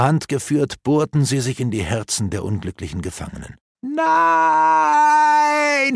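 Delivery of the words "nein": long high anguished scream. A narrator's reading. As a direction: cry out in horror. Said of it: "schrie Maeve".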